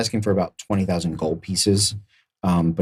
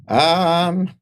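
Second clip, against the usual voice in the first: very high squeaky creature voice; hesitate, then squeak out the price; small trader's high-pitched announcement; little merchant naming price; 11 semitones higher, 3 dB louder, slower than usual. Acted. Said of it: "He goes".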